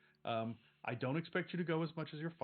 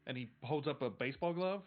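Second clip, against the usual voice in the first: ordinary talk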